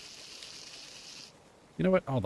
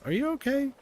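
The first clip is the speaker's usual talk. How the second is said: cutesy voice; 11 semitones higher, much faster than usual. Acted. Cooing at a creature.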